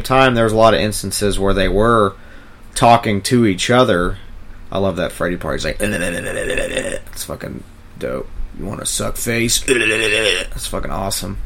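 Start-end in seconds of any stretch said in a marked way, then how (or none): none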